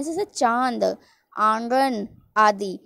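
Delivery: चांद and आंगन with nasal vowels, slow; nasalized vowels, slow. Shown as a demonstration. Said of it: "चंद्र बिंदु भी लगा दिए तो गले का भी". (चांद और आंगन धीरे, नाक से)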